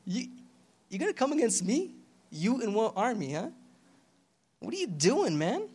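mock amazement, kept small and light; scoffing. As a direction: taunt them with quiet disbelief. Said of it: "God is scoffing at them".

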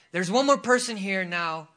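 preacher calling someone out; loud proclamation. Retold or imitated. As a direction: announce it out to a crowd. Relays God's word aloud to the congregation.

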